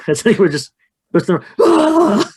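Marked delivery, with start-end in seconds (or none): 1.6-2.3 high, loud startled scream; hammed-up shriek of surprise. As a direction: let out a sudden fright scream. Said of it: "So I think I scream too".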